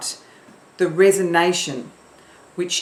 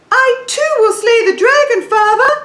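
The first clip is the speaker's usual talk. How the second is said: much higher, louder princess; princess head voice; 9 dB louder than usual. Shown as a demonstration. Teacher demos a princess's high head voice, loud. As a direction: ring it out bright from the forehead. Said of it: "after three".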